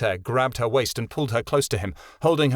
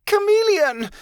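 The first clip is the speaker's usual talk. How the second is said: much higher and louder, a raw cry of loss; anguished scream; 6 dB louder, slower than usual. Acted. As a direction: scream her name as she falls. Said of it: "Martin screamed his company's name".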